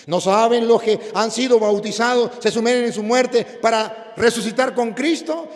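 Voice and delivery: loud, high pulpit shouting; impassioned sermon shout; fiery preaching. Acted. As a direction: preach it with full force to the crowd. Preaching at full force.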